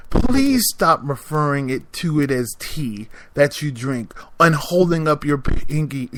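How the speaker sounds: ordinary talk, nothing marked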